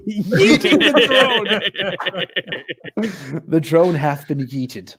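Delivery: slow, laughing through words. Real, not acted. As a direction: giggle out the words. Laughing at the drone joke.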